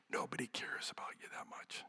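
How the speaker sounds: hushed, voiceless whisper. Real, not acted. Preacher giving students a little advice, whispered. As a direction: whisper it like a blunt secret.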